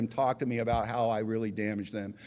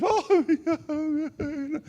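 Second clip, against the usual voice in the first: hysterical mom; 14 semitones higher than usual. Retold or imitated. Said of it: "my mom's hysterical on the phone".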